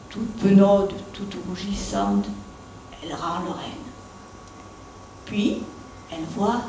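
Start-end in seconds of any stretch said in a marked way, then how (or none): none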